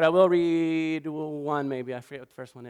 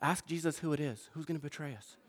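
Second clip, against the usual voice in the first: much softer, secretive aside; hushed Peter; 3 semitones lower, 12 dB quieter than usual. Retold or imitated. Lean in and keep it low. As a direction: Peter quietly urges John to ask Jesus, hushed. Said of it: "he goes".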